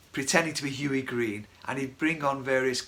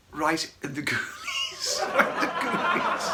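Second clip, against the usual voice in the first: laughing; slower than usual. Real, not acted. Laughing recalling the kick.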